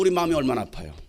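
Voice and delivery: usual speaking voice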